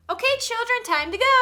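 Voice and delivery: high, singsong nice-mom voice; Mary Poppins. Acted. Mother gently rounding up kids to leave, nice voice. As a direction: call the children in a lilting high voice. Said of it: "Mary Poppins voice".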